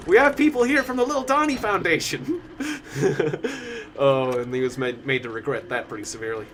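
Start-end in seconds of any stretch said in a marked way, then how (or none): 0.1-2.4 much higher mock TV-host announcement; Al Roker. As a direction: announce it to the studio, imitating the host. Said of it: "Al Roker a hard time".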